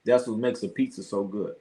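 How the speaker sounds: ordinary talk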